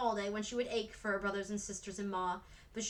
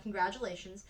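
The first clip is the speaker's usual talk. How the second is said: ordinary delivery, nothing marked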